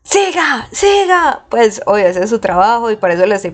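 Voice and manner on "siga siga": high, insistent coach's "siga, siga"; pushy trainer. Retold or imitated. Trainer pushing her through the third exercise, high insistent urging. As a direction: chant it up high like a trainer driving reps. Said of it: "era como".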